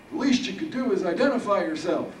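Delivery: put-on high, loud old-man voice; shaken old Harold. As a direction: play Harold high and strained, facing his killer.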